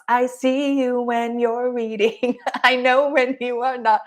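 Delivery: slow, playful jolly Santa voice with a laugh; Santa Claus. Retold or imitated. Coach teasing viewers as all-seeing Santa, slow and jolly. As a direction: stretch it out like Santa checking his list. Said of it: "I feel like Santa Claus".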